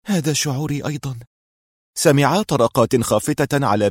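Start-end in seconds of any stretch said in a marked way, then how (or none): 0.1-1.2 high, subdued murmur; plaintive, fragile admission; near tears. A narrator's reading. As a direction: murmur as if close to crying. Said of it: "تمتمت في لهجة أقرب إلى البكاء".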